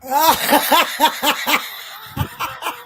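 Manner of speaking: loud, high cackle; long helpless laugh; real burst of laughter. Real, not acted. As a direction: crack up at an absurd story. Laughs at imagined headline.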